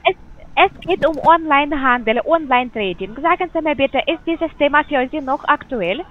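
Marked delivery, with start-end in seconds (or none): none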